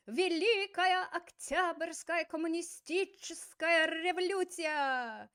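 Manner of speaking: loud, slow, pompous Lenin proclamation; all caps. Acted. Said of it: "in all caps he says".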